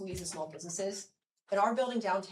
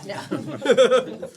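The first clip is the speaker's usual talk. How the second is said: laughing; 4 semitones higher, 12 dB louder than usual. Real, not acted. Laughs at own slip.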